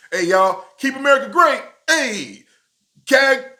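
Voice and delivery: high, loud hype-man chant; hammed-up campaign call; mock rally cheer. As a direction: shout the slogan like a crowd warm-up.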